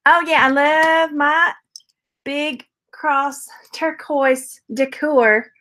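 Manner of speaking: slow, lightly put-on Texas gal voice; Texas accent. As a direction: play the Southern buyer who loves her cross decor, in a Texas accent. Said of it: "my accent I'm doing right now is not a Texas accent".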